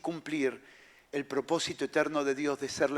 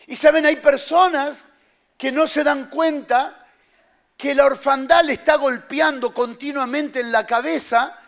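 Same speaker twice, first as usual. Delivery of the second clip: louder, higher preaching; forceful preaching; 8 semitones higher, 8 dB louder than usual. Acted. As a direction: press the point hard on the crowd. Preacher builds intensity.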